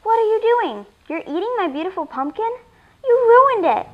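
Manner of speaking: put-on high, loud little girl's outburst; angry shout. A narrator's reading. Girl catches alien eating her pumpkin, angry shout. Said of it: "a very angry little girl shouts".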